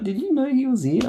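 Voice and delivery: high, disbelieving boss voice; incredulous question. Retold or imitated. Boss asking David about the idle colleague, high and incredulous. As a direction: ask it as if you can't believe it.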